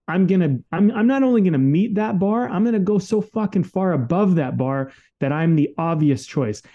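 a pumped-up fuck you attitude; defiant attitude. Retold or imitated. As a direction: throw it back at them, fierce and proud. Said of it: "a fuck you attitude".

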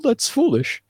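rally them with mock passion; a hammed-up impassioned plea; impassioned speech. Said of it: "he gives this whole impassioned speech".

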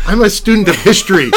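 high, loud, through laughter; laughing protest. Real, not acted. Host defends knowing history to guest, laughing. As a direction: insist while cracking up.

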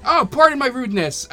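high, loud exclaimed apology; apologetic host. Acted. Councillor to neglected guests, exclaimed apology.

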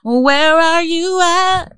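slow high teasing call; mock calling out.

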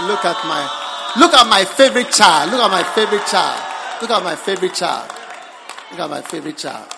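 loud, proud, repeated boast, fading at the end; a proud father showing off; voicing God. Preacher voicing God proudly pointing out a favorite child. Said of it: "God will be looking at you and say".

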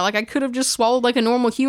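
ordinary talk

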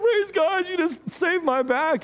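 very high, overjoyed thanks; gushing thanks. Retold or imitated. Pastor retelling how he thanked the plow driver, very high and gushing. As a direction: thank him like he saved your life.

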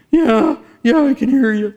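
very high, loud, strained answer; acted strained cry. Afflicted man answering the minister, high strained cry. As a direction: imitate a tormented man calling back.

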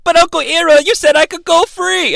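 put-on high, pleading kid voice; mock a child's high-pitched protest; high protesting boy. Aang protests to Uncle Iroh, high pleading voice.

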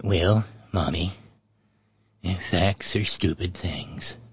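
slow, low, quiet old-president impression; Ronald Reagan. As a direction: amble through it low and halting. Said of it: "sage words of old six six".

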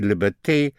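"liberté" with final "té" sounded as "tay"; French -té ending. Shown as a demonstration. Teacher showing the French ending of English -ty words, "liberté" ending in "tay". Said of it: "all words in T are T in French".

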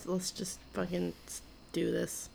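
weary retold plea. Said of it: "Mom's like".

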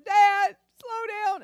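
high, loud frightened pleading; scared sister yelling at dad to slow, high and loud; panicked sister. Retold or imitated. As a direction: cry out to dad in alarm. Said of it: "And she's going".

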